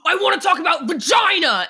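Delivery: loud, high put-on shout; imitated actor's scream; Patrick Warburton screaming. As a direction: bellow it like an actor going all out in the booth. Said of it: "Picturing Patrick Warburton screaming".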